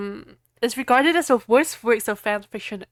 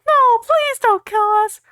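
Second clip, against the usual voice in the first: high, loud hammed-up begging; mock pleading; 13 semitones higher, 7 dB louder than usual. Vampire begging Voldemort for mercy, hammed-up. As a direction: squeal for mercy like a cartoon victim. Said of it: "pleaded Vampire".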